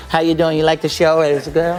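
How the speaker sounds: low, cool star impression; a small man's deep, smooth murmur. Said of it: "he was like".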